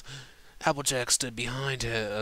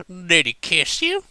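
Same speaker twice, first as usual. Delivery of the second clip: put-on drunk Applejack; 9 semitones higher, 9 dB louder than usual. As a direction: press her with a tipsy southern twang. Drunk Applejack confronts Rarity.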